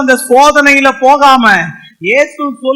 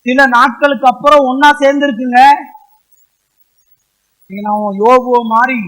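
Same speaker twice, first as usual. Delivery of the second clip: usual speaking voice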